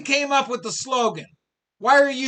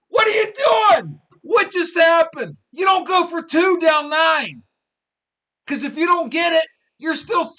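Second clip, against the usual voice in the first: loud, much higher yelling in disbelief at the coach; furious shout; 7 semitones higher, 6 dB louder than usual. Real, not acted. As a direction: yell it like you can't believe the call. Ranting at coach's call.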